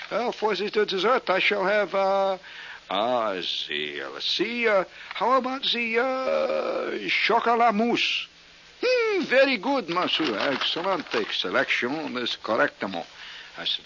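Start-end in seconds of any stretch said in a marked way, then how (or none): 0.1-8.3 high, drawn-out French ordering; mock French accent. Acting French order of dessert. 8.8-13.0 mock French waiter accent. French waiter praises the choice.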